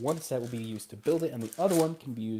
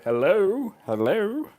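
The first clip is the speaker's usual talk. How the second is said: calling out; 8 semitones higher, 7 dB louder, much slower than usual. Real, not acted. Checking if mic works.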